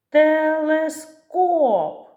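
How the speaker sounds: "Телескоп" very slow and loud, last syllable hit hard and drawn out; high, stretched "Телескоп" with a big push on the third syllable; наголос на останньому складі. Shown as a demonstration. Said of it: "Наголос падає на останній". («Телескоп» дуже повільно, з сильним протяжним наголосом на останньому складі)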